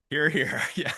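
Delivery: loud hear hear; cheer. Real, not acted. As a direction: call out the toast to the room. Cheering toast for board president.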